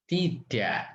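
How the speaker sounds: usual speaking voice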